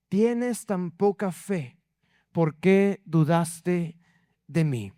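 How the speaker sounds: low, slow, gentle rebuke; a calm teacher correcting a frightened friend; unhurried low voice of Jesus; Jesus speaking. Acted.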